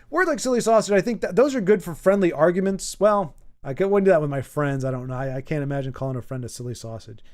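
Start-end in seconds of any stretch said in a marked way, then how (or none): none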